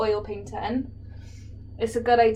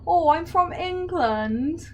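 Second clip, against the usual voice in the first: slow, higher put-on English accent, as Americans do it; mock English accent; 7 semitones higher, slower than usual. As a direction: imitate an American faking a posh English accent, drawn out. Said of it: "trying to do an English accent".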